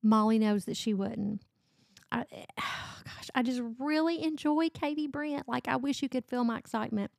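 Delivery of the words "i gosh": breathy whispered sigh of delight; soft swooning sigh, barely voiced. Real, not acted. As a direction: let out a whispered, lovestruck sigh. Overwhelmed by love for author.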